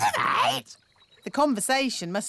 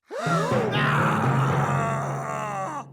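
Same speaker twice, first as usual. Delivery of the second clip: surprised gasp; 8 semitones higher than usual. Acted. Bald king grows hair.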